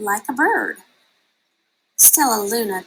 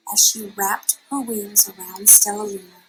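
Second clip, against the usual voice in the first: usual speaking voice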